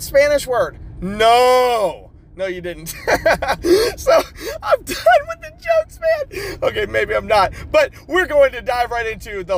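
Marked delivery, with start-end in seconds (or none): 3.0-6.6 high laughing squeal. Real, not acted. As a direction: crack up while saying it. Laughing, breaking up.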